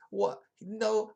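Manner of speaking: high and loud, mock outrage; mock shock. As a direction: yelp it in fake shock. Reacting to news in retelling.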